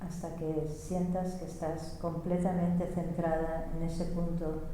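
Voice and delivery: interpret quietly without disturbing the stillness; guided meditation. Real, not acted. Interpreter centring listeners at the head, meditative.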